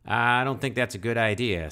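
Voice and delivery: a hint of Southern accent warning; mild put-on Southern accent. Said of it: "this southern accent".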